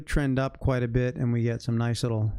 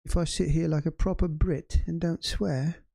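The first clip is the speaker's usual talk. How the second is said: put-on horrible British accent; 6 semitones higher than usual. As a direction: ham up a proper Brit. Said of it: "That's my horrible British accent".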